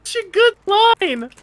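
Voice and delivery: high, slow squeal of laughter; a delighted high laughing cry. Real, not acted. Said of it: "laughing at the line".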